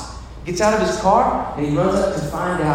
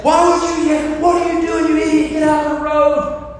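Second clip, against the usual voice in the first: high-pitched irate bus driver, acted; cursing angrily; 10 semitones higher, 3 dB louder than usual. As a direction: chew out the man blocking the bus. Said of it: "He's cursing at him".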